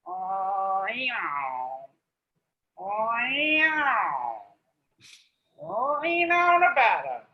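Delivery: a cat meowing the word Reno; cat saying Reno. Acted. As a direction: stretch it like a yowling cat. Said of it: "So I imagine a cat saying Reno".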